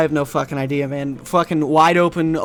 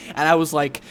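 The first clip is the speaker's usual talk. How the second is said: ordinary talk, nothing marked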